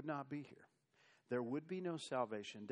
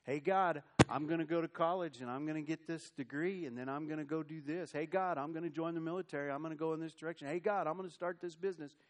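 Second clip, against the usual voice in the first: louder, fast, higher breezy planner; mock presumptuous believer; 4 semitones higher, 6 dB louder, faster than usual. Preacher mocks a believer listing plans to God, loud and fast. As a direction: rattle off plans cockily.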